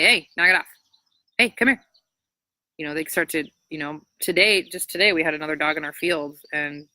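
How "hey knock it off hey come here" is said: firm call. Shown as a demonstration. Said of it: "You can verbally say".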